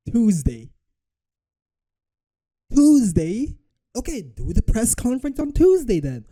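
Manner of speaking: high, loud incredulous echo; mounting disbelief. Real, not acted. Echoing the answer, increasingly incredulous.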